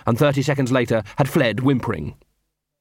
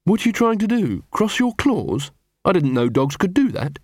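higher, mocking young woman; Angua's voice; 7 semitones higher than usual. A narrator's reading. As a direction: tease him with a raised voice. Narrator voicing Angua.

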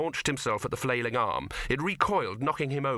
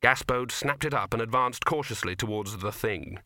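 ordinary delivery

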